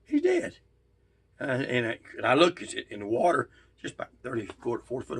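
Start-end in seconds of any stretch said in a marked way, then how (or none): 0.0-0.6 stunned cry. Retold or imitated. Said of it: "I said".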